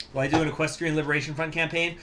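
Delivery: ordinary talk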